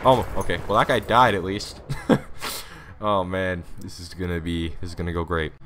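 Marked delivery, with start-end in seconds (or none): 1.8-3.0 high, soft laugh. Real, not acted. Player after dying early, high laugh. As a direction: let out a light, high giggle. Laughs at his own bad start.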